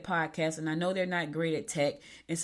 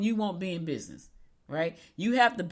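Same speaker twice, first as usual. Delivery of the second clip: ordinary talk, nothing marked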